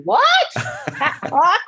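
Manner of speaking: high, loud, stretched-out "what" of disbelief; shocked what. Retold or imitated. Young fan learning the radio character is fake, shrill disbelief. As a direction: reel in stunned surprise.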